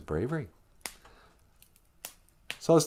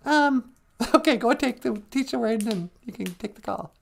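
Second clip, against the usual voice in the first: put-on a giggly child agreeing; high kid voice; 9 semitones higher than usual. Child co-host answering in a high voice. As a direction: play the kid, a bit shy.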